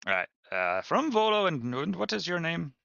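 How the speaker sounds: high, eager merchant voice. Acted. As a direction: pitch it up like a sly trader.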